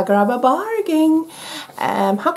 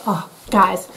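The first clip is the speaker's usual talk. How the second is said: ordinary talk, nothing marked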